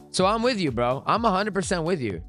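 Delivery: ordinary talk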